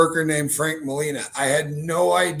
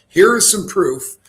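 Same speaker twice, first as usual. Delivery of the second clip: presenting proof; 4 semitones higher, 9 dB louder, slower than usual. Pretend. Presenting evidence, acted.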